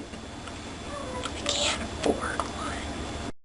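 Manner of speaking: slow, high whisper; high, slow whispered joke; whispered aside. Acted. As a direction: confide it in a breathy stage whisper. Joke aside about a Mac.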